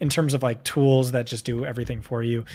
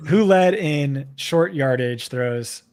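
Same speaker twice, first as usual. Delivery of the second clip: ordinary talk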